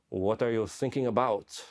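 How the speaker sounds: slow, drawn-out mock French accent; Olivier Sarbil, French accent. French cameraman shoving the camera in a soldier's face, French accent. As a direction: imitate a Frenchman probing slowly. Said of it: "almost like Pepe Le Pew".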